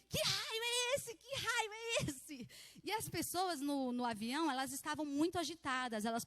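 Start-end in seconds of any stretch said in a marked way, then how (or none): 0.1-2.5 very high, slow, whining wail in imitation; hammed-up repeated cry of someone losing it; mimicked panic. Daniel keeps crying out in turbulence, mimicked panicked wail. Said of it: "O Daniel entrou numa crise de pânico".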